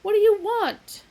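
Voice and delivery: angry. A narrator's reading. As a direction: bark it. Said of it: "rising angrily".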